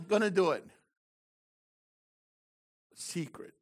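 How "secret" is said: whispered, very soft; hushed whisper. Real, not acted. Preacher whispering to the congregation, hushed. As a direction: breathe the word like a secret.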